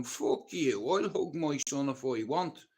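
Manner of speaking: usual speaking voice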